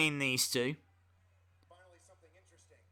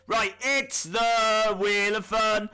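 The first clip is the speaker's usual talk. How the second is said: mock announcer; 7 semitones higher, 4 dB louder, slower than usual. Announcing the game segment.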